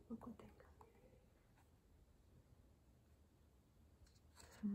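hushed whisper. Acted. Reader whispering to children about the owl. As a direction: lean in and whisper.

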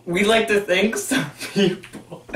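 slow, giggling thank-you; laughing. Real, not acted. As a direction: hold back laughter.

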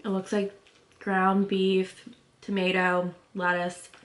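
speaking between bites; chewing while talking. Real, not acted. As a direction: mouth full of food. Seen 0: Left woman bites just before and chews visibly while describing filling.

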